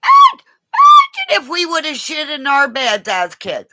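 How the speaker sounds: high, loud shouting; worked-up shouting; loud rant. Real, not acted. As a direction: shout it to the whole room. Ranting loudly about beds.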